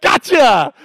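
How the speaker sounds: high, loud mocking gotcha; hammed-up triumphant accuser; Pharisees pouncing. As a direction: pounce like a gleeful snoop catching them out. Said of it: "they go".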